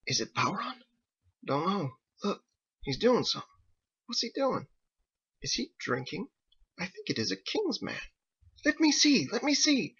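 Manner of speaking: lightly voiced sailors, hushed. Sailors spying on the town, hushed.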